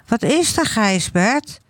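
high, questioning call from afar; mother calling back. A narrator's reading. Mother upstairs answering her son, high questioning call.

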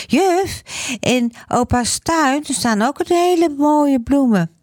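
put-on high little boy's voice, proudly telling; eager child. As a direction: chirp it like an eager small boy. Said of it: "zegt Gijsbert". (hoge kinderstem, trots vertellend)

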